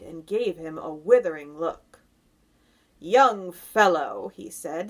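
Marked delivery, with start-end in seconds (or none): 3.0-4.3 loud, drawn-out scorn; deep, slow, scornful. A narrator's reading. Grasshopper rebuking the centipede, slow and scornful. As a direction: put him down slowly and weightily. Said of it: "speaking in a deep, slow, scornful voice".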